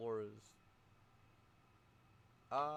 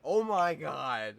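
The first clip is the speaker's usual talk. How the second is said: loud, drawn-out exclamation; astonished outcry; 4 semitones higher, 11 dB louder, much slower than usual. Real, not acted. Game master reacting to the dice, astonished outcry. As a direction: cry out slowly in real disbelief.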